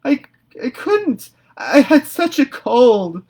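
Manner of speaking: high, loud, slow sobbing; tearful wail. A narrator's reading. Anne in tears before Marilla, high and sobbing. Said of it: "Anne dissolved into tears".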